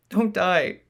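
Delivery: high, loud tearful plea; crying out. Acted. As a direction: cry out in grief. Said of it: "the Sentinel cried".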